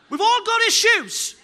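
slow, loud emphasis on all; preaching shout. Real, not acted. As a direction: proclaim it to the whole room.

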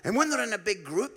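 ordinary talk, nothing marked

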